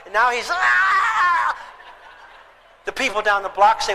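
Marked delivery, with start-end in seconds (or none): 0.0-1.6 drawn-out high furious yell; slow enraged yell. Retold or imitated. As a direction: let the rage roar out slowly. Dad explodes.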